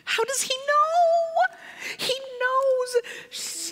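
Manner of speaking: very high, thin wail of dread; panicked child. Retold or imitated. Child dreads being found out.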